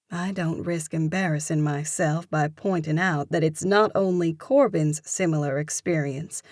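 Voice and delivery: ordinary delivery, nothing marked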